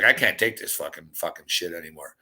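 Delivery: a defeated, sinking grumble; fed-up mutter. Retold or imitated. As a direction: mutter it like you've had enough. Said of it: "I was like".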